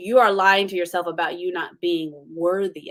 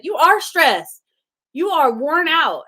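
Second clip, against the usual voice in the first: high, loud, slow and firm; voice of the Holy Spirit; 8 semitones higher, 6 dB louder, slower than usual. Retold or imitated. The Holy Spirit correcting her, loud, slow and firm. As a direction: pronounce it slowly, like a firm inner voice. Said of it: "the Holy Spirit said".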